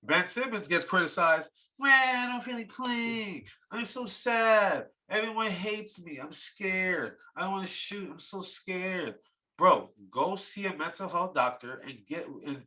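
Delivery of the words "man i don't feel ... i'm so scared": high, drawn-out crybaby whine; mock crying whine. Host mocks criticized Ben Simmons as crying, in a high whine. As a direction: whine like a sulking crybaby, hammed up. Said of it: "Stop crying that you're getting criticized".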